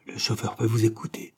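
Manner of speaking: ordinary delivery, nothing marked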